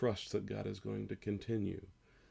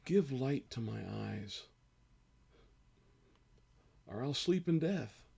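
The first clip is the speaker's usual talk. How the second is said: slow, pleading psalm reading that swells louder and higher on each how long; slow emotional scripture reading; 4 semitones higher, 3 dB louder than usual. Acted. Pastor reading Psalm thirteen aloud to his church, slow and pleading. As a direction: read it like a lament, aching and crying out to God. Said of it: "I'm gonna read it one more time".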